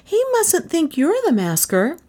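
put-on high, anxious little girl; pleading. A narrator's reading. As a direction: urge him in a high voice. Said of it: "Gentian pleaded".

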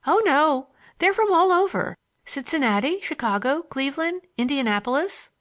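high-pitched, chatty schoolgirl voice; lively young girl, pitched well up. Acted. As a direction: reel off the answer in a high girlish lilt.

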